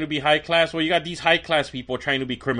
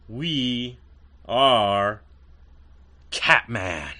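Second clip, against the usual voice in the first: slow, paused, lower sign-off with a beat between words; dramatic catchphrase; 4 semitones lower, much slower than usual. Acted. Host signing off to listeners with the catchphrase, dramatic and lower. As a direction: land the show's signature line with weight.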